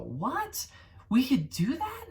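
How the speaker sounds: high, astonished disbelief; imitating a stunned brother; brother's amazement. Brother marvelling at the Unreal demo, astonished. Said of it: "to see him go".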